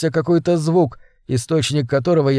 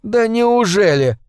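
slow, higher, venomous hiss; hissed through teeth; 7 semitones higher, slower than usual. Acted. John hisses at Sarah through gritted teeth. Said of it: "прошипел я сквозь сжатые зубы".

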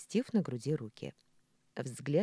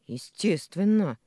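slow, louder, emphatic grumble; grunted reply; 5 semitones higher, 9 dB louder, slower than usual. A narrator's reading. Николя justifying the fight to Маша, grumbling. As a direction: grumble it out firmly. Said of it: "буркнул Николя".

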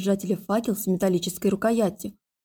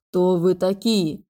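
slow booming stone voice; grinding stone statue; slower than usual. A narrator's reading. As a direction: rumble it like a living statue. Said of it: "прогремел каменный голос".

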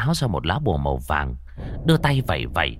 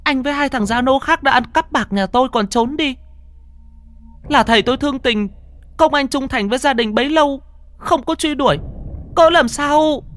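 put-on high, loud young woman; crying out; 4 dB louder than usual. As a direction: cry out in shock and anger. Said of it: "cô Hoa kêu lên".

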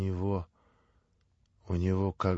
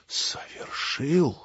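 stunned echo; 5 semitones higher, much slower than usual. Acted. Ivan stunned, repeating the word.

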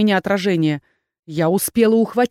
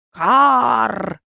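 raven caw; 3 semitones higher than usual. A narrator's reading. Raven answers thanks.

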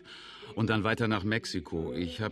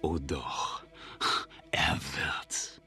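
low, soft, sinister whisper; a schemer's quiet threat to his partner; menacing whisper; 8 semitones lower, 6 dB quieter, much slower than usual. Acted. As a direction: lean in and hiss the certainty. Villain predicts enemy's coming.

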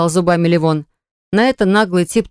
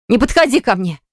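higher, sharp shriek; 4 semitones higher than usual. A narrator's reading. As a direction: shriek him away. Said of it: "взвизгнула она". (взвизгнула)

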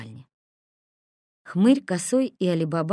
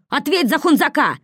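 angry demand; 9 semitones higher, 8 dB louder than usual. A narrator's reading.

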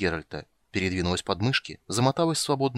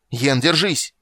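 higher, louder cry of warning; alarmed raised voice; urgent shout; 5 semitones higher, 7 dB louder than usual. A narrator's reading. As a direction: call out to someone in danger. Warning as bridge gives way.

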